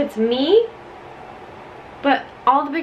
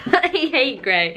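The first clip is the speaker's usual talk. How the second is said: slow, laughing confession; 4 semitones higher, slower than usual. Real, not acted. Joking to viewers about her gray sweater, laughing.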